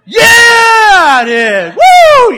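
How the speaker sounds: loud high whoop of delight; exuberant whooping. Retold or imitated. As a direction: belt out a huge, drawn-out yes. Preacher's exaggerated retort to stoic pastor.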